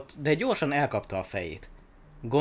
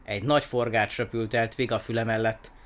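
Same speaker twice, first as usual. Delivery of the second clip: ordinary delivery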